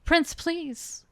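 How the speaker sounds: high, loud desperate plea; pleading cry. Acted. Dying Luca begs the prince, high pleading cry. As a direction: beg for help in pain. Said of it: "He was sobbing in pain".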